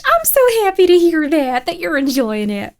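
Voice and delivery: high, loud, delighted thanks; thrilled and grateful; happy gushing. Real, not acted. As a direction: gush with real gladness. Thanks a long-time subscriber.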